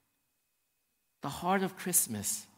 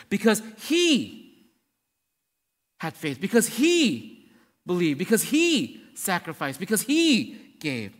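each "he" stretched long and pushed high; stress on HE; 4 semitones higher, 7 dB louder, slower than usual. Acted. Preacher giving the third-person answer, stress on HE. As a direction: draw out every "he", then pause.

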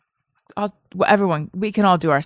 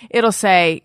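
ordinary talk

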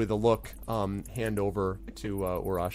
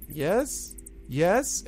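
higher, tentative goblin yes; a touch of nervous pleading, pitched up; 8 semitones higher than usual. Acted. Goblin Gleek pleading to Pyron, mildly nervous. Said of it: "looking nervously over his shoulder".

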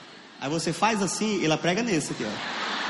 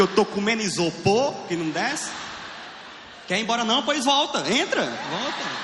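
mock scolding; 5 semitones higher than usual. Voices himself scolding the toilet.

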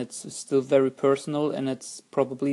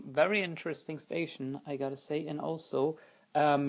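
usual speaking voice

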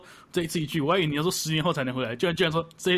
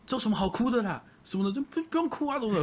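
mock tough teen; 8 semitones higher than usual. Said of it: "我就转头就说".

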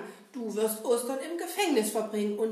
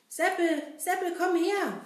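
louder, higher call; 6 semitones higher, 5 dB louder than usual. Acted. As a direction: call Seppel from far away. Kasper calls Seppel.

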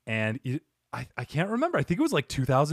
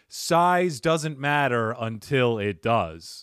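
slow punchline; much slower than usual. Real, not acted. Punchline delivered.

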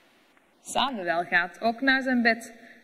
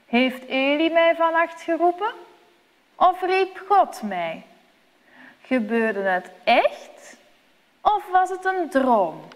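put-on higher, musing boy's thoughts; wondering boy; 7 semitones higher than usual. A narrator's reading. Samuel wonders at morning whether it was a dream. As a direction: puzzle it over, half to himself. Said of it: "Hij denkt".

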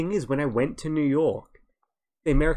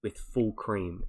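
slow, careful coffee order, softer and lower; 3 semitones lower, 4 dB quieter, slower than usual. Retold or imitated. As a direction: spell out the order slowly for a confused barista. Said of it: "I'll, I'll slow down".

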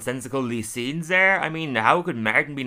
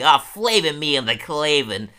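high, loud cartoon professor exclamation; pitched-up mock professor wail; Professor Frink; 5 semitones higher, 6 dB louder than usual. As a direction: cry out as a flustered cartoon scientist. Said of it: "ends with him going".

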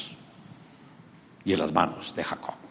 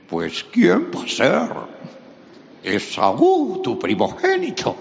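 much higher, louder put-on voice; faking Esaú; 10 semitones higher, 5 dB louder, slower than usual. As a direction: bluff it out as his brother. Jacob pretends to be Esaú.

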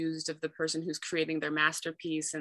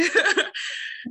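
laughing; 13 semitones higher, 5 dB louder than usual. Real, not acted. Laughs at her own stuck thought.

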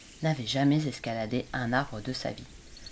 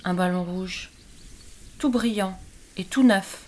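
plus haut et plus fort, ravis; lapins enthousiastes; 5 semitones higher, 5 dB louder than usual. A narrator's reading. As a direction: lapins qui vantent leur ballon. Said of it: "répondirent les lapins". (les lapins décrivent leur ballon, ravis)

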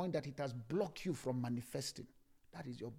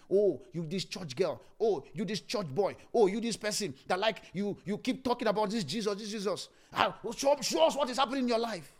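mocking taunt; 6 semitones higher, 8 dB louder than usual. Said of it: "when people are mocking you".

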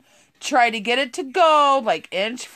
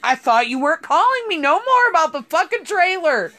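higher, louder exasperated retort; 6 semitones higher, 3 dB louder than usual. Retold or imitated. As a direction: snap back, fed up. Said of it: "I was like".

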